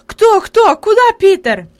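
put-on frantic old woman's questions; agitated. A narrator's reading. Grandmother questions the boy in alarm, agitated. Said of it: "беспорядочно повторяла бабушка, ещё больше волнуясь".

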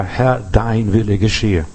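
low, slow, surrendering prayer; solemn prayer. Acted.

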